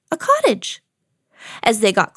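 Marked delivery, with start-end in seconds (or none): 0.1-0.8 high-pitched excited storybook cry; excited exclamation. A narrator's reading. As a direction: exclaim it high, delighted at the discovery. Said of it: "Minnie exclaimed".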